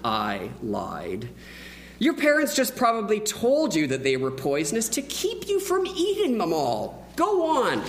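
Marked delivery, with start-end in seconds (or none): 2.0-7.8 lightly voiced coaxing boy; coaxing kid. Boy urging his friend, lightly childlike coaxing. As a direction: egg him on like a cheeky kid.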